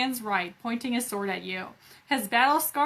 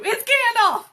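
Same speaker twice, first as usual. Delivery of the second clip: much higher and louder, mock thrilled; excited gasp; 11 semitones higher, 8 dB louder than usual. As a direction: blurt it out like a fan. Jokey excited recognition.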